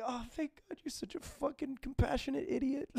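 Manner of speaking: high, soft voice of relief; a small, high-pitched inner thought, retold; hushed relief. Retold relieved thought while weeping.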